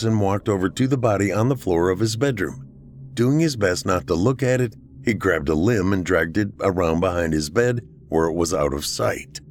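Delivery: usual speaking voice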